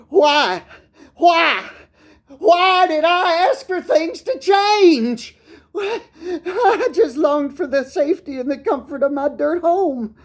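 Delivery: high, loud, tearful cry of regret; anguished wail. Acted. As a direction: wail the questions in desperation, then stay high, near tears. Dog recalls hurricane, desperate regret.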